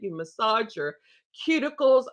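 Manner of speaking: usual speaking voice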